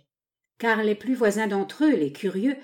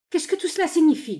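high-pitched, bewildered question; startled, raised-pitch questioning; astonished demand; 9 semitones higher, 4 dB louder than usual. A narrator's reading. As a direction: demand to know what is going on. Newcomer demands an explanation.

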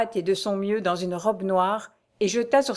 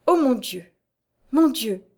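a mildly voiced despairing exclamation; anguished cry; 4 semitones higher than usual. A narrator's reading. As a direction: cry out, wringing her hands. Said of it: "s'écria la jeune femme". (s'écrier avec désespoir, sans excès)

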